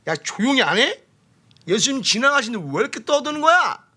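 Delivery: high-pitched mock rebuke; acted scolding. Bystanders scolding the one crying out, mock indignant. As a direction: act out an irritated telling-off. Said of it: "옆에 사람들이 뭐라고 해요?".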